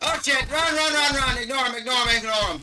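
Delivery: panicked high shouting; being chased. Real, not acted. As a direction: yell in a frantic rush. Spotted by guards, fleeing in game.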